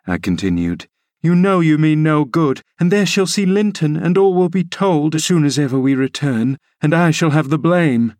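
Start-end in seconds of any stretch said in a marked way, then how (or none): 1.2-8.1 higher, worried protesting voice; anxious nurse Ellen. Acted. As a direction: plead against him, fretting.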